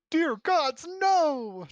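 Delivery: high, loud terrified scream; scream of fear. Acted. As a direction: scream in panic. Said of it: "Tomlin screams, his voice high with fear".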